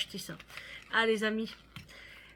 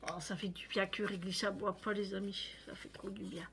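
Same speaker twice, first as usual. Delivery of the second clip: ordinary delivery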